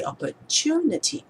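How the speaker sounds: "opportunity" with "tu" lifted in pitch; stress on third syllable. Shown as a demonstration. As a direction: hit the "tu" in "opportunity". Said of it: "the third syllable".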